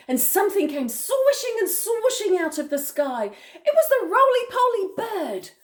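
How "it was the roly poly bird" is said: ordinary delivery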